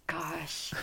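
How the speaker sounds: whispered gosh; breathy stumped whisper. Real, not acted. Wife stumped by gun question.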